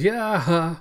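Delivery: high, loud "yeah" of triumph; villain's gleeful crowing. Acted. Cyborg unveils his brute to the old man, gleeful crowing. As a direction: crow like a delighted villain.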